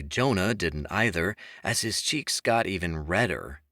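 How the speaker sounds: plain reading voice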